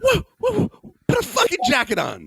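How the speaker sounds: startled high-pitched protest, easing down; alarmed outcry. Retold or imitated. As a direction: yelp it out like you just saw a kid with no coat. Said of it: "I'm like".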